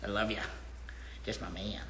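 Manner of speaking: low, soft fond murmur; tender to dog. Real, not acted. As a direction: tell your dog you love him.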